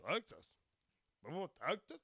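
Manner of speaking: put-on incredulous questioner; mock surprise. People reacting to his home state, mock incredulous surprise. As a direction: echo it back as if disbelieving. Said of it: "very surprised".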